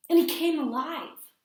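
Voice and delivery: put-on loud high child exclaiming; child calling out the news to Mom; thrilled child. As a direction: shout the wonder of it. Child insists bear lived.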